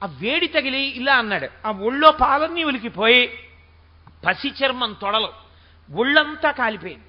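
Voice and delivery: ordinary talk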